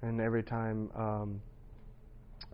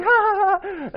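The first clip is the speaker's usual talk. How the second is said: high embarrassed laugh; 13 dB louder, slower than usual. Retold or imitated. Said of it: "And they're like".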